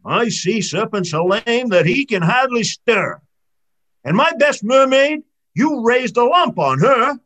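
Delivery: put-on mad old man of the sea. A narrator's reading. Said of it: "muttering in his whiskers and madder".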